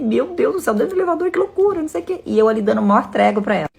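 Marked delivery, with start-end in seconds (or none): 0.0-2.2 high-pitched, incredulous exclamation; retold shock. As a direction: play up the scandalized disbelief in a much higher voice. Retold shocked reaction to gossip.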